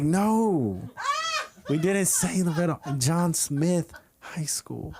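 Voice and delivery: "John Smith High School" spelled out slow and deliberate; exasperated friends correcting the lie; recited correction. Retold or imitated. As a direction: drill the rehearsed answer. We had this recited.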